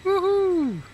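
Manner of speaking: excited cheer. Real, not acted. Joyful cheer after takeoff.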